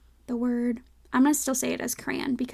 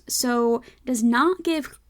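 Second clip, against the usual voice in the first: ordinary talk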